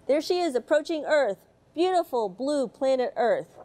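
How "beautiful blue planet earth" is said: usual speaking voice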